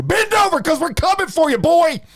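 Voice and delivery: high, loud holler of orders; full-throated, hammed-up bellow; fake officer shouting. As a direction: yell it like a mock police raid. Fake officer yelling orders at scammer.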